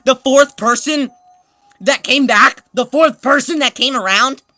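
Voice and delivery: loud, high worked-up telling; exasperated rant. Real, not acted. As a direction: drive each phrase out loudly. Exasperated retelling.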